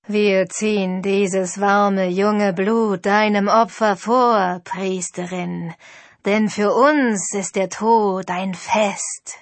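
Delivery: menacing ghost chorus, slow and loud; eerie ghost voice. A narrator's reading. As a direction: drag each word out like a voice from the grave. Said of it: "eine schaurige Stimme".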